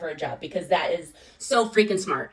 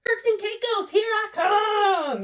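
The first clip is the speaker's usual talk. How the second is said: very high, stretched-out gleeful call; giddy cheer; 12 semitones higher, 3 dB louder, much slower than usual. Retold or imitated. Cheering about free flights, very high giddy call. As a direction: sing it out like vacation is booked.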